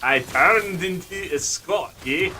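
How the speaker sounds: drawn-out, thicker Scottish accent; Scottish brogue. Shown as a demonstration. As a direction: lean into the Scots, stretching the vowels. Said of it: "I can go much more of the brogue".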